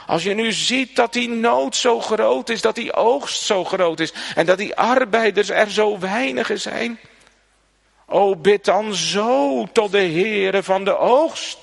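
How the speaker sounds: loud, high, impassioned exhortation; fervent preaching. Acted. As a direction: drive the command home to the congregation. Preacher urging prayer with force. (predikant die de gemeente aanspoort: vurig en luid)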